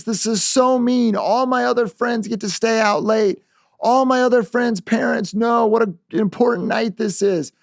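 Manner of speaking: put-on high, whiny teenage protest; a daughter hamming up injustice to dad; teen's act of outrage. Daughter putting on a show of outrage to her father, high and whiny. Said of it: "she puts on the act".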